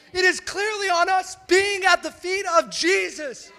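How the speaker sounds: loud, emphatic sermon climax; impassioned preaching. Real, not acted. Preacher urging the church to the feet of Jesus, impassioned. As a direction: drive each contrast home with force.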